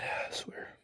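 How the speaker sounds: breathy whispered aside under his breath. Real, not acted. Wrestling fan whispering his frustration while reviewing the match. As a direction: mutter it to yourself in a whisper.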